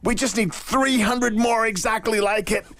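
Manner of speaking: high, gushing agent voice; mad American agent. Retold or imitated. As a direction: play an overexcited Hollywood agent. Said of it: "this mad American agent".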